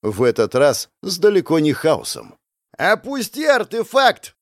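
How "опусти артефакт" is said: high, drawn-out shouted order; slow, pitched-up yell. A narrator's reading. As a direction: shout a threat from a distance. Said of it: "орёт мне парень".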